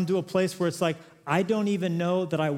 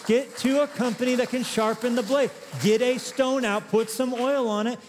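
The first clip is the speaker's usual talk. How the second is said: higher, fervent exhortation, slowing on the stone; rousing preaching; 6 semitones higher than usual. Acted. Preacher urging the congregation to sharpen their blade, rousing. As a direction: urge them on like a rallying preacher.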